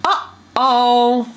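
Uh-oh with a hard catch at the start; a tiny cough into Uh-oh; glottal uh-oh. Shown as a demonstration. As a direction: pop the vowel with a clean glottal stop. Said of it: "So say this after me".

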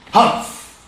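loud unvoiced huff; indignant father elephant's huff; grumpy humph. A narrator's reading. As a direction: huff it out in disgust. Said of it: "replied Daddy Heflapunt".

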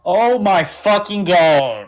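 slow, high, loud disbelief; shocked outburst. Real, not acted. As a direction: cry out in shock. Sudden death in game.

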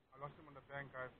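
ordinary talk, nothing marked

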